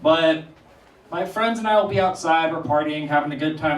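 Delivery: usual speaking voice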